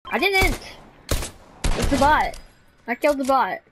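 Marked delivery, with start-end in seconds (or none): 0.1-2.4 indignant protest. Real, not acted. Defends himself in quarrel.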